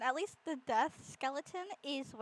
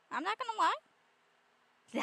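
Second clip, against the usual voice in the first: excited; 10 semitones higher, 3 dB louder, much slower than usual. Real, not acted. Excited about bone sheds.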